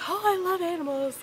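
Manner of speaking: high, exaggerated animal-lover voice; mock gushing. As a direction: parody someone cooing over animals. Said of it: "I'm not claiming to be".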